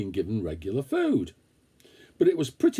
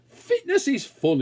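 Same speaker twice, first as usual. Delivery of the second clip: a hint of a cheery thought voice; 12 semitones higher than usual. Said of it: "he thought".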